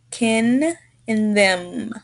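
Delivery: very slow "Kin", "them", final nasals held long; demonstrated N and M. Shown as a demonstration. Teacher drills endings for a student, drawn-out "Kin" and "them". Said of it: "work on pronouncing N and M".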